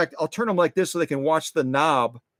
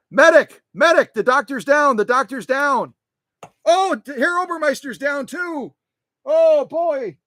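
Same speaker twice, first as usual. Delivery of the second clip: higher, louder mock alarm; man down shout; 10 semitones higher, 5 dB louder than usual. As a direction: call for a medic in jest. Mock alarm over fallen figures.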